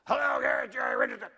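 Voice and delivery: big booming phone voice, loud; loud imitation of a big-voiced owner; Jerry Richardson's booming voice. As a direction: boom the greeting like a team owner. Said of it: "Bob can't do that voice".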